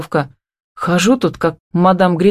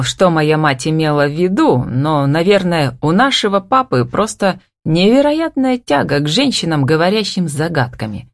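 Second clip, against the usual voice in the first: ordinary delivery, nothing marked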